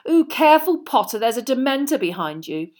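high taunting fake fright; mock alarm. A narrator's reading. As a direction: tease Potter. Malfoy taunting Harry.

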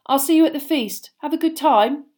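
put-on casual cheer; forced offhand. A narrator's reading. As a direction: send them off trying to sound unbothered. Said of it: "what he hoped was an offhand voice".

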